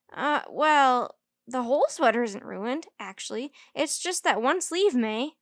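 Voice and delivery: ordinary delivery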